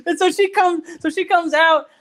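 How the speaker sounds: high-pitched, through laughter; laughing while talking. Real, not acted. Storyteller getting through his embarrassing story, laughing. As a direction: push the words out while cracking up.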